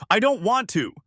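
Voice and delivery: high, loud refusal; emphatic yell. A narrator's reading. Kang Dante running from the prisoner, yelling. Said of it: "emphatically yelling".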